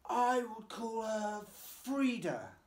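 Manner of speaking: put-on high, slow little girl naming; child's voice. Lucy naming the owl for the handler, high and slow.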